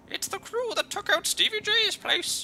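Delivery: high, loud squeaky panic; squeaky panicked thug. Acted. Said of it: "The shotgun-wielding thug squeaks in panic".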